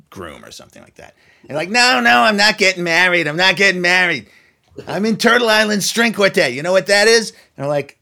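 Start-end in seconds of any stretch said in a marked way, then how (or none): 1.7-7.3 a hint of a New York accent, higher and eager; lightly voiced New York caller insisting; [name]'s New York voice. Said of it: "I sound like I was still really a New Yorker".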